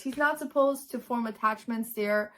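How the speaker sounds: ordinary talk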